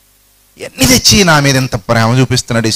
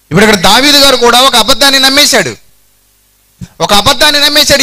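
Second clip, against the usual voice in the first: higher, louder pulpit emphasis; fiery preaching; 10 semitones higher, 3 dB louder than usual. Real, not acted. Preacher to congregation, higher and louder at full force. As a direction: drive the point home to the congregation.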